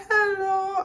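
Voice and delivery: high, wailing phone voice; mock crying. Daughter phoning dad after the crash, mock-crying. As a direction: imitate yourself crying down the phone, high-pitched. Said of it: "I'm calling them back crying".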